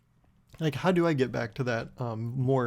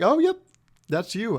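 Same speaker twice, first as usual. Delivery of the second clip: high, loud imitation of an eager clerk; eager confirmation; 8 semitones higher, 5 dB louder than usual. Pizza guy confirming free soda to customer, imitated eagerness. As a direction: burst out the good news, pitched way up.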